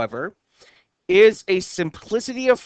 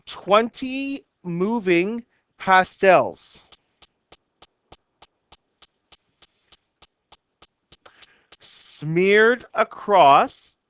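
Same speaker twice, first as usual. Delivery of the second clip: slow, unhurried reading of a haiku, each phrase left to hang; teacher reads the haiku to the class, slowly and evenly; slow poem reading; much slower than usual. Shown as a demonstration. Teacher to class, slow measured poem reading. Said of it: "and it goes".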